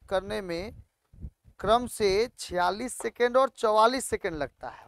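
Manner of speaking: loud, measured classroom read-out; word by word, projected to the class; reading question aloud. Real, not acted. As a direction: read the problem out slowly and loudly.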